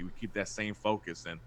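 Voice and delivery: usual speaking voice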